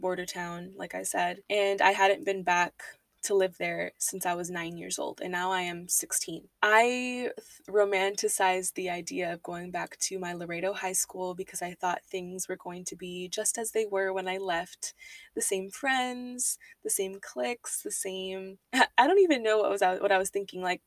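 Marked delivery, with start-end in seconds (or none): none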